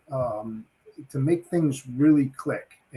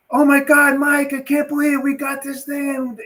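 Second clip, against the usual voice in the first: high and loud, bursting with excitement; pumped-up excitement; 12 semitones higher, 6 dB louder than usual. Retold or imitated. Telling his partner they won the business, pumped up and excited. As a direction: gush the good news like you can't contain it. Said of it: "like, really pumping up".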